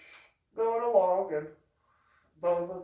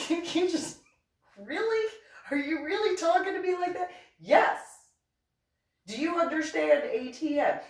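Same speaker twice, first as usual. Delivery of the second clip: very high, affronted retort; acted exasperation at top pitch; incredulous scolding; 13 semitones higher than usual. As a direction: squeal it in utter disbelief. Retold quarrel, incredulous scolding.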